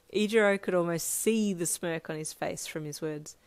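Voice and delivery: plain reading voice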